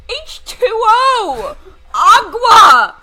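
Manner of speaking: high, loud comic yelling; mock-outraged shouting. Real, not acted. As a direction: yell it in exaggerated protest, stretching the words. Mock outrage about drinking water.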